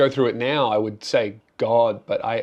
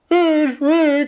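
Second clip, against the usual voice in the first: mock plea; 8 dB louder than usual. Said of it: "until I went".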